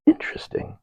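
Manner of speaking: breathless whisper, no voice; whispered interesting. Retold or imitated. Hearing the demon prayer, a whispered aside. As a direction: mutter it under your breath. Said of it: "I say".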